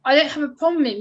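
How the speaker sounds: retelling own reply. Retold or imitated. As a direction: protest your innocence firmly. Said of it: "And I was like".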